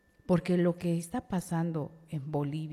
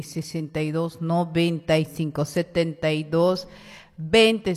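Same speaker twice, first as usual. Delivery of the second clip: announcer callout; 7 dB louder than usual. Real, not acted. Radio host calling out numbers.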